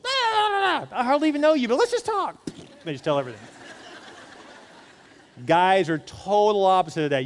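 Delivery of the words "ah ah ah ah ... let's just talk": high, loud chattering woman voice; mock a woman gushing on, much higher. Pastor mocks how women overshare, in a high gushing voice. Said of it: "Women will just".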